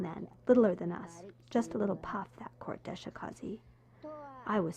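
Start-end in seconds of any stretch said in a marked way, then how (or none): 1.5-3.6 hushed, conspiratorial whisper; breathless secret about the now-important man; whispered aside. Real, not acted. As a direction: whisper it so only we hear.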